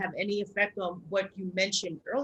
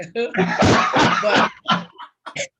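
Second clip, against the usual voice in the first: big burst of real laughter; loud laugh; 3 semitones higher, 11 dB louder than usual. Real, not acted. Laughs at Spike Lee story.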